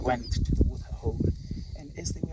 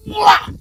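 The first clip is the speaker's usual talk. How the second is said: short, high, loud attack yell; charging cry; 11 dB louder than usual. Acted. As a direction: burst out as two animals lunge. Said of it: "they went like".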